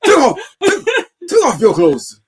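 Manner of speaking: high, loud mock West African prophet; hammed-up African prophet voice; west african accent. Mock prophet skit, Ghana.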